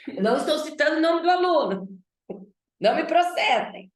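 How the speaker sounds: playful fake worry; mock alarm. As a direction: pretend to fear a lawsuit, hammed up. Teacher jokes about being sued.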